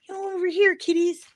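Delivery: high, sing-song coaxing voice; hammed-up high-pitched enticer; luring kiddies with treats. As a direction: coax the kiddies over with candy. Resumes luring voice.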